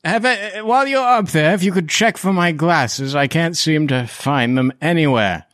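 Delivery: put-on high, befuddled old gentleman; doddering Van Richten. Lost man asks party for his glasses, doddering.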